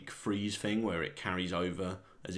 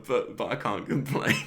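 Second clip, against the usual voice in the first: louder and higher, breaking into laughter; laughing; 6 semitones higher, 6 dB louder than usual. Acted. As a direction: laugh your way through the words. Cracking up at his own bit.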